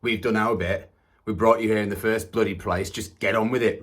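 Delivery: exasperated parental telling-off; fed-up parent. Acted. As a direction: berate him and be done with it. Acts out parents dismissing him.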